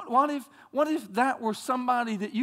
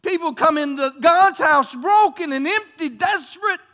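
emphatic preaching; 5 semitones higher, 9 dB louder than usual. Acted.

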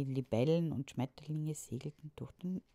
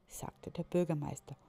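ordinary delivery, nothing marked

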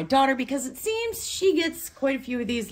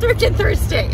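excited announcer; 12 semitones higher, 10 dB louder than usual. Real, not acted. Show intro catchphrase.

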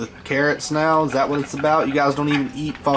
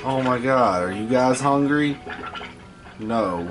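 ordinary delivery

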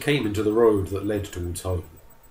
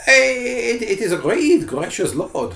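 higher, stammering, shaken; frightened agreement; 14 semitones higher, 5 dB louder than usual. Acted. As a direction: stumble over the first word in fear.

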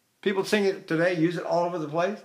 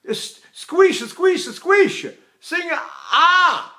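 high, emphatic master-class scolding; 9 semitones higher, 4 dB louder, much slower than usual. Retold or imitated. Master teacher scolds American singer, high emphatic urging. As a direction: urge the singer on, much higher and louder. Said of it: "[name] in his master class".

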